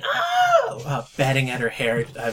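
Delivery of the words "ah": high-pitched scream "Ah!"; mock scream. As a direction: shriek it out. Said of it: "she starts screaming".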